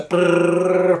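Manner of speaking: long, even rolled brrrr like a printer; teletype printout trill. Shown as a demonstration. Lesson narrator showing how text prints out, imitated printer trill. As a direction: imitate a rapid-fire printer with a sustained trill. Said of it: "print out like that".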